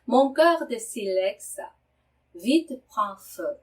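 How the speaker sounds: careful teacher reading; slow model reading. Shown as a demonstration. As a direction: read it out syllable by syllable for learners. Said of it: "이렇게 발음을 하셔야 되고요".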